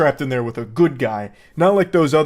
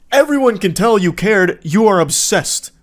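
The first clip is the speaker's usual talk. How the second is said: higher and louder, calling him out; exasperated raised voice; 5 semitones higher, 5 dB louder than usual. Real, not acted. Venting at the clingy coworker in a raised, exasperated voice. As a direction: let it burst out, fed up. Scolding the obsessive coworker directly.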